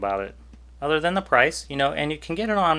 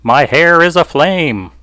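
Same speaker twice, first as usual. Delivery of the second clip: slow, booming announcer line; loud and drawn-out like a showman; 3 semitones higher, 12 dB louder, slower than usual. Shown as a demonstration. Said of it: "that was my announcer voice".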